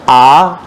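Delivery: आ held for two beats; drawn-out आ, held evenly; long vowel. Shown as a demonstration. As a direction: stretch आ to double length. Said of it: "इसी को दीर्घ बोलना हो तो".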